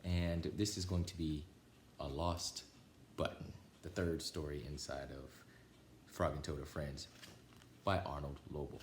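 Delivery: ordinary delivery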